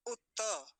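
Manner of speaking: उत्त said "utta", high with open ending; उत्त in two full syllables; full second t. Shown as a demonstration. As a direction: sound the final a of उत्त. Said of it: "दूसरा तकार जो है वो पूरा होगा".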